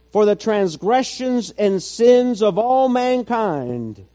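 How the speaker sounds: high, slow, stretched-out sermon phrases; impassioned preaching. Real, not acted. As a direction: preach it forcefully, drawing out the key words.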